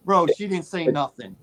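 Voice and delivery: normal talking voice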